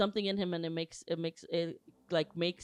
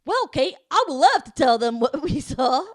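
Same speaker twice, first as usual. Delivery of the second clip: overeager; 5 semitones higher, 10 dB louder than usual. Acted. Mock eager reply to Kate.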